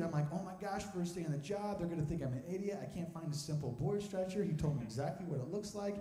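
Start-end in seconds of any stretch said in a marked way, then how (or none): 0.3-4.4 low, hushed fretting; soft, worried self-talk, lightly acted; retold frustration. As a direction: fret under your breath, acting it out.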